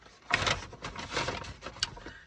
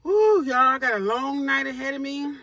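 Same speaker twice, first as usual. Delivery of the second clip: excited; 6 semitones higher, 8 dB louder than usual. Real, not acted. Cheering at start of long night.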